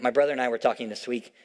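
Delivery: usual speaking voice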